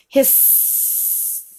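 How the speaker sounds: long, soft snake hiss. Pretend. Parent showing child how the snake hisses, drawn-out hiss. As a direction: stretch the final s into a hiss.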